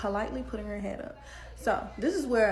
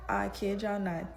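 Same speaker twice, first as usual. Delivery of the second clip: ordinary talk, nothing marked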